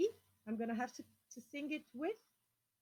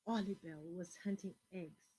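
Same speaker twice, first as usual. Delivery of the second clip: ordinary delivery, nothing marked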